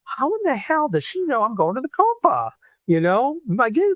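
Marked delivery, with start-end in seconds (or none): 0.0-2.5 stunned high-pitched disbelief; a much higher, astonished squeal of a question; high shocked retelling of his own thought; blown away. Retold or imitated. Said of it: "Kate, I was blown away".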